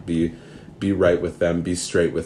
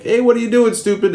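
mock cop; 6 dB louder than usual. Mock cop addressing a kid.

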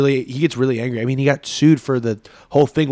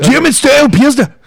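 put-on loud, high, harsh German accent; shouted mean German; 12 semitones higher, 8 dB louder than usual. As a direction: bark the beer name like an angry German. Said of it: "Like, really mean German".

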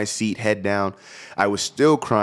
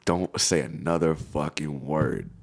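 cold threat; 4 semitones lower, 5 dB quieter, slower than usual. Retold or imitated. Furious retold warning to girlfriend.

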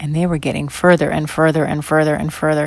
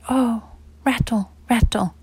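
put-on whispered baby words; 6 semitones higher than usual. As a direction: breathe it like a small child. Toddlers' first words, whispered.

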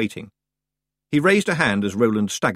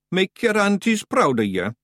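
high, mildly raised Scottish cheer; cheerful shout; 9 semitones higher than usual. A narrator's reading. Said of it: "shouted in his cheerful way".

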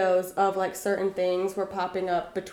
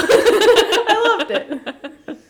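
laughing; 11 semitones higher, 8 dB louder, much slower than usual. Real, not acted. Laughing reply to joke.

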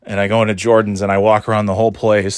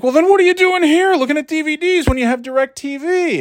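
high, squeaky mock salesman voice. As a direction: squeak it out like a baffled clerk. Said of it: "And he's like".

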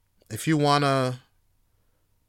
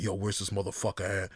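sneaky search; 6 semitones lower, 8 dB quieter than usual. Retold or imitated. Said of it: "it's like".

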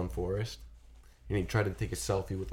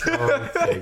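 mock shock; 10 dB louder than usual. Mock outrage.

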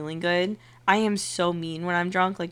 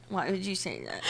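soft mock-hurt question; saddened; 10 dB quieter than usual. Drunk friend to her sober friend, saddened and soft. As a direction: imitate a wounded, pouting friend quietly. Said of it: "so slowly and so saddened".

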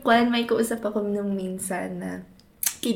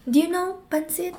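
higher, slow, sing-song asking; mock foreigner question; 7 semitones higher than usual. Friends parodying foreigners asking about food, high and slow. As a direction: imitate a curious foreigner, slowly. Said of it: "mocking foreigners' questions".

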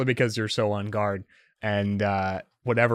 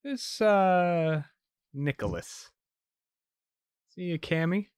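sneering; 8 semitones higher than usual. Acted. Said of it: "a sneer kind of forms, and she says".

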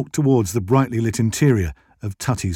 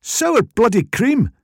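high, slow, exasperated Scottish demand; fed-up, pitched-up insistence; 12 semitones higher, 3 dB louder, slower than usual. A narrator's reading. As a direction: drag out each word in frustration. Exasperated woman repeats her request.

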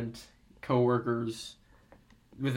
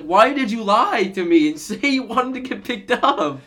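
mock exasperated; 7 semitones higher, 4 dB louder than usual. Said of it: "I was like".

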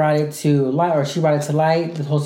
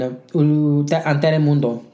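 slow Spanish pronunciation of Telemundo; Spanish accent; much slower than usual. Shown as a demonstration. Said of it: "I had to say that in a good Spanish accent".

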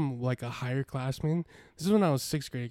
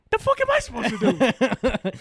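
loud high protest; mock protest; 11 semitones higher, 10 dB louder than usual. Son imitating himself protesting to his dad, loud high. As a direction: yell back at dad in disbelief.

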